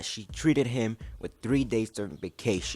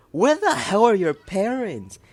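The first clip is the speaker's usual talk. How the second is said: higher, louder exasperated demand; outraged; 11 semitones higher, 6 dB louder than usual. Real, not acted. Commentator yelling at story characters about missing parents, outraged. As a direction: demand it in disbelief.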